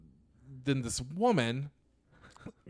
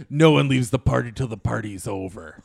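louder, ominous bouncer voice; menacing tough guy; 9 dB louder than usual. Retold or imitated. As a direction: loom over him and lay down the rule. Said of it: "and he goes".

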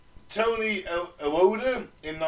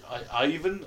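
bad Russian accent. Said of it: "I can only do Russian when I say".

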